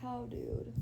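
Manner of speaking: soft whispered aside. Real, not acted. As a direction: hush it under the breath. Whispered aside during live.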